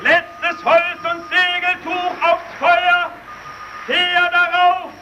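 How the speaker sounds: urgent commands. Acted. Urgent orders to feed signal fire.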